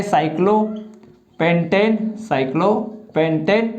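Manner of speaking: slow cyclopentane with a gap before the ending; half-speed, split-up cyclopentane; piece-by-piece dictation. Shown as a demonstration. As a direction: draw out cyclopentane for note-takers. Teacher dictates the term in pieces.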